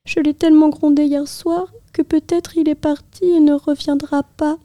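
a fond mother fretting; worried mother. A narrator's reading. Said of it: "se disait-elle".

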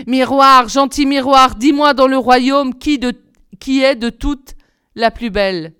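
proud demanding question to the mirror; queen to her mirror. A narrator's reading. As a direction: summon the magic mirror like a vain queen.

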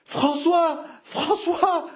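high-pitched frenzied calling; delirious howl. A narrator's reading. Jeanne calling to her returning husband, delirious howl. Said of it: "hurla Jeanne, délirante".

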